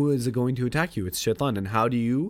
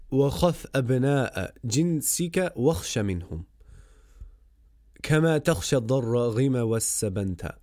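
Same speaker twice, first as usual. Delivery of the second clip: rapid verse recitation; 3 semitones higher, much faster than usual. Acted. Recites Arabic verse of poem.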